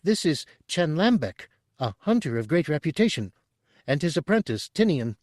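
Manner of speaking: high, bubbly little alien voice; burbling Chadrafan. A narrator's reading. Chadrafan burbling to the hunters, high and eager. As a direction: burble it like an eager small creature.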